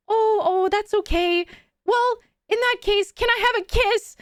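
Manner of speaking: high-pitched imitation of a bashful man; shy stranger. Shy man asking tourists for a kiss, imitated in high bashful voice. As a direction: mimic a timid guy making a cheeky request. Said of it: "overly shy".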